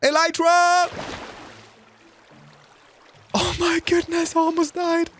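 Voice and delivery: high startled cry; excited shout. Real, not acted. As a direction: shout in relief. Near death while flying.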